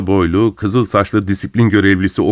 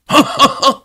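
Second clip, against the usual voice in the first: high-pitched mocking laugh; jeering guffaw; gleeful cackle. A narrator's reading. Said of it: "keyifli bir kahkaha attı".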